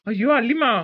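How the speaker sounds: husband thrilled by the news; overjoyed. Acted. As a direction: gush with happy affection.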